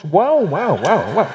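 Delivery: "Guau, guau" as playful dog barks; dog barking. Pretend. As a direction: bark "guau, guau" like a dog for a joke. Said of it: "Ya parecía plática de perros". (ladridos juguetones de perro)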